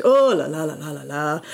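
emphatic French exclamation with repeated las; double-plus "oh la la". Shown as a demonstration. Showing the stronger version of oh la la, emphatic. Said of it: "a sort of double plus version".

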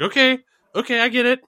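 high, hurried Elon backing down; scared Elon. Retold or imitated. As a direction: back down high and quick. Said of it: "he's just like".

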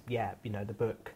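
ordinary talk, nothing marked

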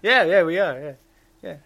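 laughing echo. Real, not acted. Echoes mockingly, laughing.

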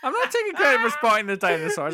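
loud, laughing denial; laughing shout. Real, not acted. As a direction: protest through laughter. Repeats denial louder, laughing.

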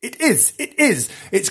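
high, insistent enthusiasm; gushing enthusiasm. Real, not acted. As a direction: insist on it with glee. Gushing over the cape.

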